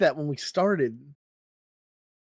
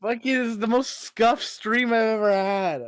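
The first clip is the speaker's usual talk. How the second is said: high-pitched real exasperation; raging; 8 semitones higher, 4 dB louder than usual. Real, not acted. Streamer fed up with the scuffed stream, exasperated raging. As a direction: complain hotly, voice pushed up. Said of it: "[name] Raging is the funniest thing".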